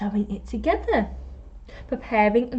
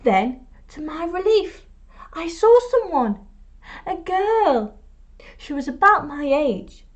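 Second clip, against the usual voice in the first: higher, brighter with relief; relieved excitement; 6 semitones higher than usual. A narrator's reading. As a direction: lift the voice at the relief of finding someone. Relief at finding girl.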